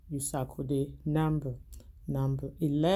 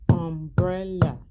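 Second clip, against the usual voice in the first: syllable-count demo; 7 dB louder, slower than usual. Shown as a demonstration. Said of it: "How many times?".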